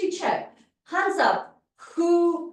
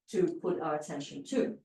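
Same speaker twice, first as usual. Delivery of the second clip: ordinary talk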